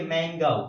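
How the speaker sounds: मैंगो said with a clear open ending vowel, as मैंगा; correct mango. Shown as a demonstration. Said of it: "सही है".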